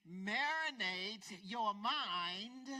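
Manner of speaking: slow, stressed marinate; slow emphasis. Acted. Preacher savors the new word to congregation, slow.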